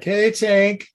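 concerned hero calling to his dazed friend; Hawk's voice. Retold or imitated. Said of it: "Hawk is like".

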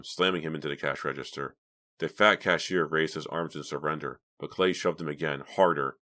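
plain reading voice

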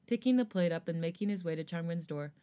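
normal talking voice

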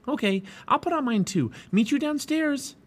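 put-on high, eager girl's voice; eager Annie. A narrator's reading.

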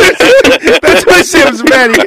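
laughing through the words. Real, not acted. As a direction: say it while cracking up. Laughing while telling.

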